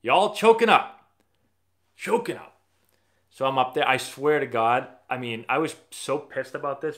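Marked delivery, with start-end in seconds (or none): 0.0-2.6 high, loud, slow coach's bark. Retold or imitated. Coach barks at the drafted players, loud and high. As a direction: bark it at the whole team like a fed-up coach. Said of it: "And he's like".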